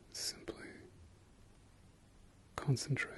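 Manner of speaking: normal talking voice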